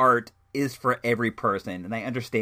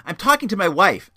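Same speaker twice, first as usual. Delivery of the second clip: loud, higher mock indignation; indignant retort; 7 semitones higher, 8 dB louder than usual. Moviegoer answering a complaint about his talking, loudly indignant. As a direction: imitate an affronted man talking back.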